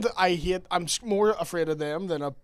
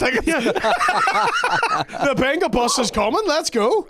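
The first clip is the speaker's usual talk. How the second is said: higher and louder hype call; hyped announcement; 9 semitones higher, 7 dB louder than usual. Pretend. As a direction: shout it like a party bus arriving. Mock rallying the group.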